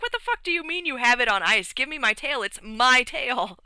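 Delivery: high-pitched mock outrage, slowing on the last words; acted-out indignant outburst; outraged demand. Acted outrage over stolen tail.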